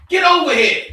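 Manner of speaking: retold officer yelling at a kid; cop's command. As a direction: bark it like a cop with a gun drawn. Cop gun in face ordering.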